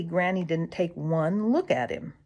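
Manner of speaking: ordinary delivery, nothing marked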